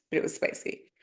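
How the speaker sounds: ordinary talk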